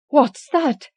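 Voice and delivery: startled high cry in the dark; frightened cry. Acted. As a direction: jolt awake in fear. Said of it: "cried the old woman".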